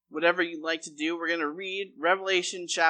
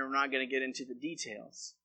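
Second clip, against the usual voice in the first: ordinary delivery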